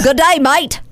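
high, mock Australian greeting; Australian accent. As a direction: put on a broad Australian accent.

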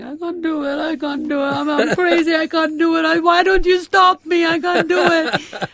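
high-pitched wailing whine, rising to a near-shout; whining. Retold or imitated. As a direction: whine like a worn-out kid who wants to quit. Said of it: "I would start whining".

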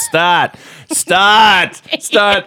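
frustrated commands. Retold or imitated. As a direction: yell start at the car, rising every time. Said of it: "he goes".

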